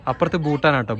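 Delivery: usual speaking voice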